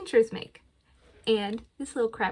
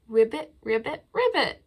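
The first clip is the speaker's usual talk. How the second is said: croak, then squeak the last; frog croak; 3 dB louder than usual. Pretend. Librarian repeating the frog sound, croaking. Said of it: "What makes a sound ribbit".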